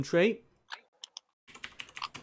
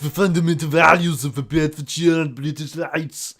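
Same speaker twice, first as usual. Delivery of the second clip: put-on louder, Austrian accented tirade; Arnold Schwarzenegger impression; 2 semitones higher, 4 dB louder than usual. Player rants as Austrian strongman in Austrian accent. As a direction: rant in a heavy Austrian accent. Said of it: "Arnold Schwarzenegger".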